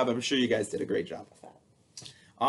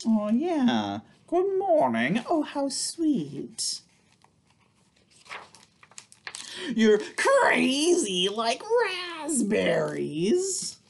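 silly high voice; 10 semitones higher, much slower than usual. Acted. Silly voice on book pages.

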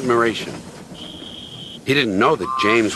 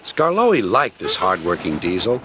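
ordinary delivery

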